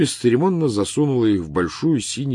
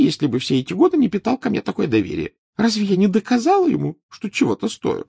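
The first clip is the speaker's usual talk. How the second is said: Trelawney voice; 12 semitones higher, 6 dB louder than usual. Acted.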